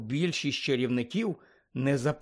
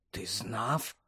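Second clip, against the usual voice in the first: hushed, soft and lower; whispered question; 4 semitones lower, 8 dB quieter than usual. A narrator's reading. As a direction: ask it under your breath, voice lowered. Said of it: "прошепотів він".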